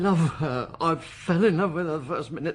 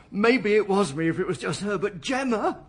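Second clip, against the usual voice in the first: plead, getting more desperate; rising plea; 8 semitones higher, 4 dB louder than usual. Acted. Suspect protesting he could never hurt his daughter, rising high and loud. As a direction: voice climbing high and louder.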